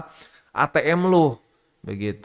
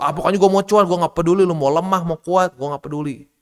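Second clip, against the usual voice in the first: louder, stubborn mock voice; mock greedy investor; 4 semitones higher, 6 dB louder than usual. Imitating a greedy trader who only wants profit, loud and stubborn. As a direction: brush off the analysis like a greedy gambler. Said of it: "Bukannya mencoba untuk".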